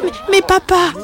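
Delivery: high, stammered plea; pleading little dragon. Acted. Banished young dragon pleads with his father, wounded. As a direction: stammer a hurt protest.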